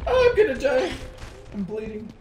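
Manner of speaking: panicked. Real, not acted. Player hit in game.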